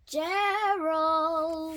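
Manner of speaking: loud, drawn-out call to a friend; hailing a friend loudly. A narrator's reading. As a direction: call the name out long and loud. Pig calls out to friend.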